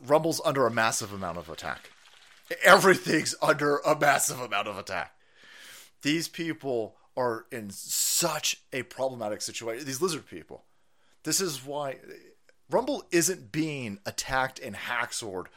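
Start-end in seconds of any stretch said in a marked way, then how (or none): none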